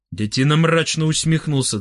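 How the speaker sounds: usual speaking voice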